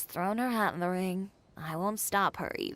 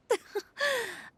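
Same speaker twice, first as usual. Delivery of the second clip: a short, confident laugh; amused laugh. Real, not acted. Chiori laughing at Paimon's either-or worry, confident laugh.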